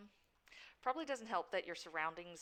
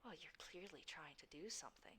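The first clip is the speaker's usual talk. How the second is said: dark whisper behind you; 19 dB quieter than usual. Acted. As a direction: breathe it darkly from behind. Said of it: "it's the Shadow's voice".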